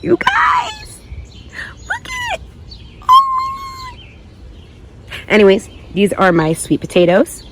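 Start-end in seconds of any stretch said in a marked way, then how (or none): 0.0-5.2 extremely high squeal to the viewers; excited squeal. Real, not acted. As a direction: squeal at them with glee. Squealing over her potatoes.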